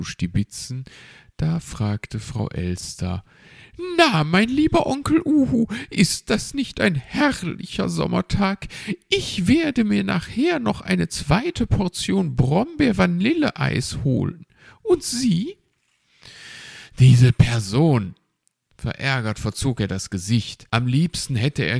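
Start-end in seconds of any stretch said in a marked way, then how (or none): 4.0-15.6 chirpy magpie. Acted. Frau Elster speaks to Onkel Uhu. 17.0-18.2 exasperated. Acted. Owl fumes at the magpie.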